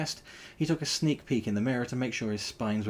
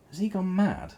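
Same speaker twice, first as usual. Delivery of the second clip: wondering; 11 semitones higher, 5 dB louder than usual. Acted. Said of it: "Porker wondered".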